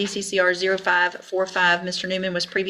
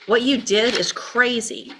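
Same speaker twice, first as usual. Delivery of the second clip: slow, higher, emphatic disbelief; incredulous rebuke; 5 semitones higher, 4 dB louder, slower than usual. Real, not acted. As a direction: spell out your outrage slowly. Judge rebukes the defendant.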